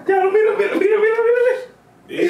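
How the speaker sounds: high excited calling. Real, not acted. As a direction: call out, way up high.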